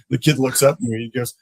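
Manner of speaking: ordinary talk, nothing marked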